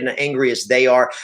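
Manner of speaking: normal talking voice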